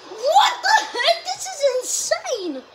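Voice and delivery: loud, very high, drawn-out exclamation; shocked outburst. Real, not acted. Creator reacting to his viewer demographics, shocked outburst. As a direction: cry out high in disbelief.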